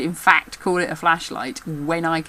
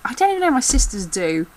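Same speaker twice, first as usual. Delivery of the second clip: ordinary talk, nothing marked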